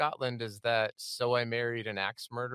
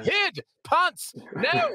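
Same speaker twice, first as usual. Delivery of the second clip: shrieking, much higher, louder mock Scottish yells; bad Scottish accent; 7 dB louder, much slower than usual. Host showing guest his one Scottish accent, hammed-up mock Scottish. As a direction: ham up a Scottish dad yelling. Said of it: "that's my only Scottish accent I can bring".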